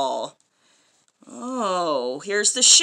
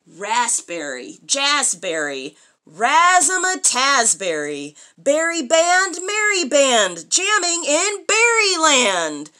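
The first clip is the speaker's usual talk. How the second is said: building rhyme; 2 semitones higher, 4 dB louder than usual. Acted. Mother building a berry rhyme for her child, rising. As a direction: swing the rhyme up to a big finish.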